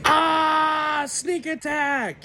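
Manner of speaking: high, drawn-out shouted battle cry; mock battle yell. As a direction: yell it like a proud sneak attack. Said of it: "and he yells".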